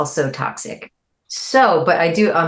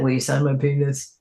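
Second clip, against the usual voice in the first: [name]; 2 semitones lower, 3 dB quieter than usual. Retold or imitated. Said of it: "[name] said that".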